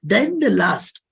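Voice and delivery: ordinary talk, nothing marked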